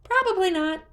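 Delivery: very high, loud admission; sheepish creature. A narrator's reading. Hestu admitting to Link in a very high voice. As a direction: own up sheepishly. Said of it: "Hestu admitted".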